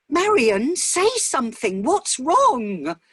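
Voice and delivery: high, loud, urgent husband begging her to speak; a frightened husband pleading with his silent wife; alarmed plea. Acted. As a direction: press her for an answer, scared. Charlie alarmed at wife's silence.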